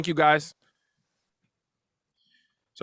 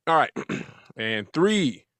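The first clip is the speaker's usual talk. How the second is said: normal talking voice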